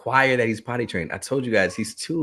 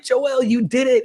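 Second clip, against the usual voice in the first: much higher, cheering praise; excited praise; 4 dB louder, slower than usual. Retold or imitated. Parent praising little son on the potty, high cheer. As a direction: cheer the toddler on.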